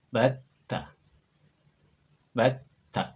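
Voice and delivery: Batta, bat then ta joined; doubled t. Shown as a demonstration. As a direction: hold the tt in Batta. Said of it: "dilafalkan bat, kemudian disambung ta".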